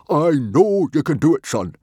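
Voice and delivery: very loud, high, rousing encouragement; enthusiastic booming father. A narrator's reading. Stoic cheering on his son before the race, booming. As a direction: pump your son up. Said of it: "he said enthusiastically".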